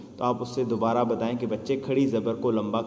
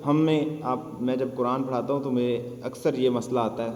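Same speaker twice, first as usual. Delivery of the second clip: normal talking voice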